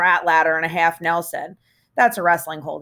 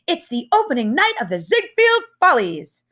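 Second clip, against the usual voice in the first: high, showy announcer flourish; movie musical star; 11 semitones higher, 3 dB louder than usual. Pretend. Holden playing a musical star while tap dancing, showy high voice. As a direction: announce it like a Broadway opening. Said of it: "imitating one of those guys in the movies".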